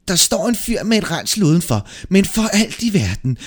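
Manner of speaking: high, loud panicked warning; terrified devil raising the alarm; frightened devil. A narrator's reading. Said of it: "blev han så forskrækket".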